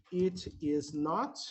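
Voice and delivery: slow, each word apart; slow full form. Shown as a demonstration. Teacher slowly models the full form for the class. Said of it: "que é o".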